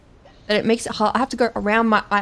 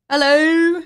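loud, higher-pitched hello; calling out; 5 semitones higher, 8 dB louder than usual. Real, not acted. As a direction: call out as if nobody is answering. Calls out about missing order.

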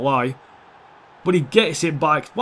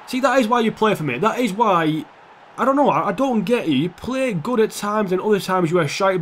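goal joy; 6 semitones higher than usual. Real, not acted. His player just scored a goal.

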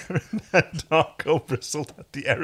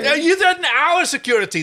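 accusing; 7 dB louder than usual. Acted. Alien delegates argue.

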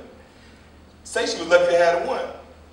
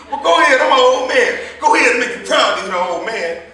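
louder, higher defiant taunt; 7 semitones higher, 10 dB louder than usual. Acted. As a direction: dare him to hit an old man. Old man taunts during quarrel.

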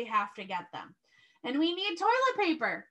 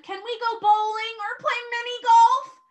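put-on eager child; 9 semitones higher, 8 dB louder than usual. Teacher voicing eager child.

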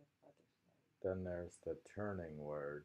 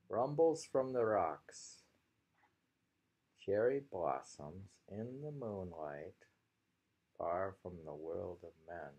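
poem reading; 2 semitones higher, 7 dB louder than usual. Acted. Teacher reading a haiku to students, opening loud then settling. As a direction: give the rocks weight, then ease into moonlight.

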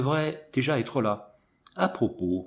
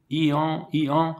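high imitated hee-haw; voiced donkey cry, pitched up; donkey bray; 4 semitones higher, 3 dB louder, slower than usual. A narrator's reading. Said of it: "il n'eut pas plutôt crié".

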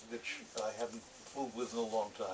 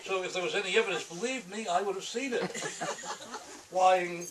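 louder, higher, laughing through the lines; laughing loud reading; 7 semitones higher, 8 dB louder than usual. Acted. Author reading his noir comic to an audience, laughing and loud. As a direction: push it up, cracking up as you go.

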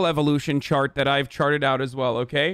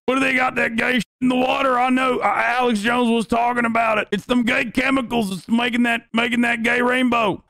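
hammed-up yokel suspicion; conspiracy yokel; 5 semitones higher than usual. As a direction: play a ranting backwoods conspiracy believer.

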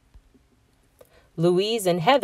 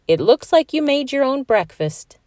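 amused mom; 8 semitones higher than usual. A narrator's reading.

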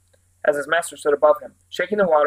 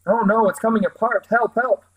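panicked cry for help; 6 semitones higher than usual. Acted. Raft breaking apart in storm.

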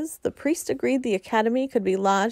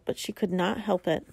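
ordinary delivery

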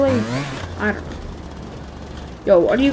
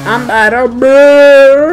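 loud frustrated; 6 semitones higher, 10 dB louder, slower than usual. Real, not acted. Frustrated at game.

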